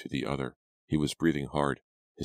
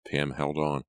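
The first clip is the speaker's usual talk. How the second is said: plain reading voice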